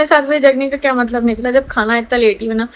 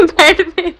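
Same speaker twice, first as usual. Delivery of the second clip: ordinary talk, nothing marked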